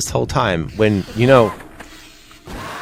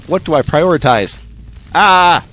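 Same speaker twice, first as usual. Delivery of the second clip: raised pitch building to a loud cry; hammed-up overwhelmed yelp; mock panic; 7 semitones higher, 5 dB louder than usual. Gamer torn over what to prioritize, mock panic.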